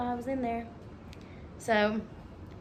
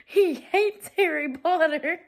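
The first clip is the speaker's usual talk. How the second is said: mock indignant; 8 semitones higher, 8 dB louder, slower than usual. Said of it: "She said".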